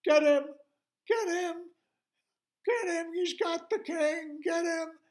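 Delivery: very high, very loud crowd yells; full-force high-pitched shouting; yelling. Acted. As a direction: scream urgent orders to the others. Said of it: "other voices yelling".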